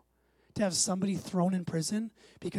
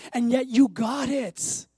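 slow emphatic preaching; 4 semitones higher, 6 dB louder, slower than usual. Acted. Preacher building to climax.

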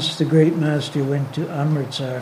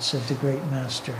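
ordinary delivery

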